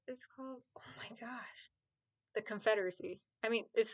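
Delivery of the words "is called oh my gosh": embarrassed whispered aside. Real, not acted. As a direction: whisper it to yourself, flustered. Unsure, embarrassed about a word.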